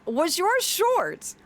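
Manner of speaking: ordinary delivery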